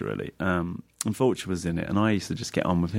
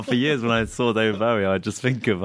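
usual speaking voice

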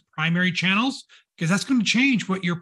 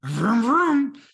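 mock car revving, pitched up; playful engine noise; 6 semitones higher than usual. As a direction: rev it like a toy car, "vroom vroom". Imitates a car after sign-off joke.

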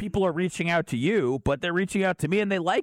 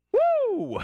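excited whoop. Real, not acted. Bursting in, out of breath.

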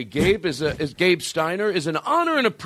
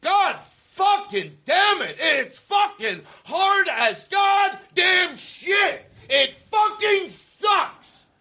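high, slow furious yell at the ones staying clean; a big, drawn-out shout of fierce praise; 12 semitones higher, slower than usual. Retold or imitated. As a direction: roar every word slow and high, as if cheering them on. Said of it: "take what I was yelling".

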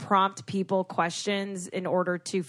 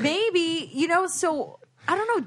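higher voice; 8 semitones higher, slower than usual. Shown as a demonstration. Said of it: "talk a little higher".

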